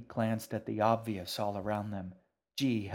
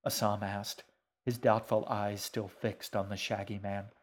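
plain reading voice

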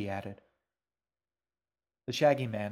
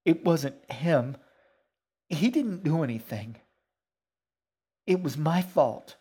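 put-on upset girl; 6 semitones higher, 8 dB louder than usual. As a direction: plead the case louder, pitch raised.

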